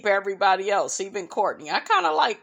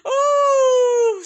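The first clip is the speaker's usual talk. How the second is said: loud high squeal of laughter; laughing hard; 10 semitones higher, 4 dB louder than usual. Real, not acted. Recalling the judging scene, squealing with laughter. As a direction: burst out laughing.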